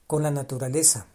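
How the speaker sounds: ordinary delivery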